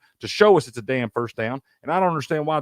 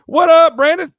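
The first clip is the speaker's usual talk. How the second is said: slow, drawn-out, much higher and louder hello; excited greeting; 11 dB louder than usual. Real, not acted. Host hails Brandon in the livestream chat, loud and stretched. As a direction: call out a big welcome to a chat regular.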